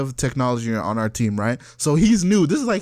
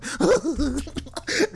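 high wheezy giggle; helpless chuckle; 9 semitones higher, 4 dB quieter than usual. Retold or imitated. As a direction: chuckle on and on, unable to stop. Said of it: "I was still chuckling".

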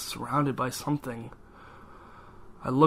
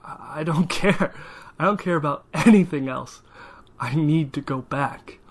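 desperate outburst; 2 semitones higher, 6 dB louder than usual. Acted. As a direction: shout it out, beyond caring.

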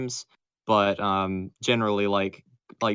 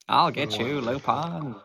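a lawman threatening a thief; cowboy sheriff voice; 3 semitones higher than usual. Retold or imitated. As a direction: drawl it like a Western sheriff. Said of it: "makes him sound like he's a cowboy sheriff".

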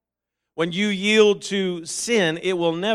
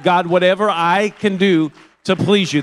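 loud, impassioned cry to God; fervent prayer; 7 dB louder than usual. Acted. Preacher showing what holiness says to God, loud and fervent. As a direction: raise the voice in earnest devotion.

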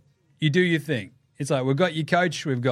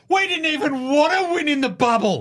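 much higher whiny excuse voice; Lou Williams mock; 11 semitones higher than usual. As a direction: whine the excuses like a sore loser. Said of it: "coming and going".

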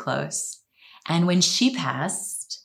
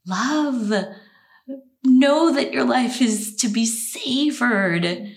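higher, slow and tender; tender message; 9 semitones higher, 3 dB louder, slower than usual. Retold or imitated. Voicing her late husband's words to the host, slow and tender. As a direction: deliver it slowly, like a loving voice from beyond.

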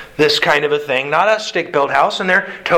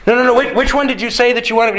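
higher, fast mock-bewildered question; confused coworker; 6 semitones higher, faster than usual. Worker baffled by hammer or homer, mock-confused asking. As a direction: play the puzzled guy who misheard.

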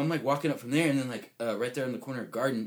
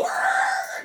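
breathy imitated crowd scream; unvoiced mock scream. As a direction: rasp out a scream with no voice.

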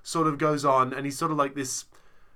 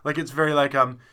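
normal talking voice

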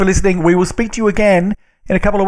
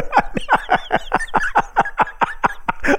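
a high, breathless laugh of dread; high breathy laugh; 6 dB quieter than usual. Real, not acted. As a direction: laugh helplessly at the bad news. Dreads the game.

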